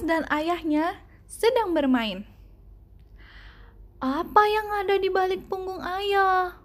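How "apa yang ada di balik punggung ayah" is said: put-on lightly higher child voice, curious; a little boy asking his dad; storyteller voicing young Douglas. A narrator's reading. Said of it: "tanya Douglas".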